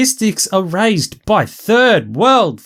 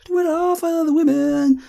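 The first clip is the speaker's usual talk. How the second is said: mild mock high voice; 5 semitones higher, 5 dB quieter than usual. High mocking quip.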